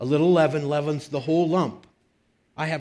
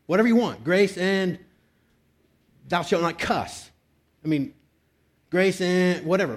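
raised, higher preaching voice driving the point home; preaching at full force; 4 semitones higher, 4 dB louder than usual. Acted. Pastor urging the congregation, preaching loud and high.

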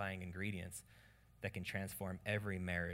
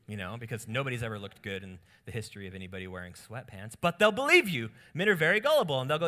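ordinary talk

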